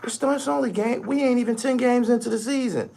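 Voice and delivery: high, mocking falsetto voice; mock the excuse-makers way up high; mimicking players' excuses. Fan imitating cruising players making excuses, high mocking voice. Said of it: "they gonna say".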